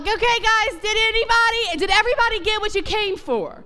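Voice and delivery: high, pressing appeal to the crowd; urgent rally-leader call. Retold or imitated. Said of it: "And I'm like".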